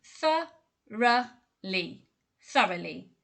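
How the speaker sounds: very slow, each syllable separated. Shown as a demonstration. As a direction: break thoroughly into slow syllables. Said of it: "I'll break it down into syllables for you".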